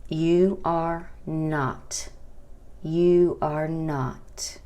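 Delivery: "you are not" said very slowly, word by word; slow teacher demo; slow model phrase. Shown as a demonstration. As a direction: spell it out slowly for learners. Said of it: "La forma negativa sería".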